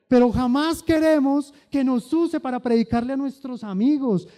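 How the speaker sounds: a preacher rousing the congregation; impassioned preaching. Acted. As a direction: preach it with fire. Preaching at full force.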